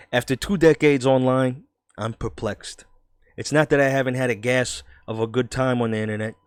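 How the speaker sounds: put-on New York voice; New York accent. Redoing the journalist's opening lines, New York accent. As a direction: read it out like a New Yorker. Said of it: "I didn't do the New York voice".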